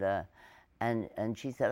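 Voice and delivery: ordinary talk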